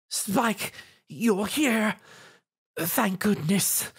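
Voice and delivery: high, slow, shaken relief; stammered. A narrator's reading. Exhausted Rarity stammers with relief on seeing Spike, high and slow. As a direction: falter it out, half-relieved. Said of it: "she stammered".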